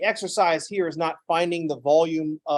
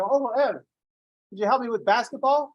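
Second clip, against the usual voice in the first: put-on high little-girl voice, pitched up; child asking; 7 semitones higher than usual. Goddaughter asks uncle for basketball help, high childlike voice. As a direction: ask sweetly like a young goddaughter.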